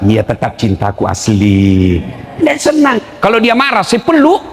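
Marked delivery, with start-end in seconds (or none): 0.0-2.0 slow, low, tender reassurance; tender sweet talk. Retold or imitated. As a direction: coo it to calm her. Husband sweet-talking wife. (suami merayu istri, pelan dan rendah)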